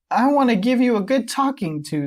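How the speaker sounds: put-on high, loud boyish voice; boy scolding. Dipper telling off his sister, high boy voice.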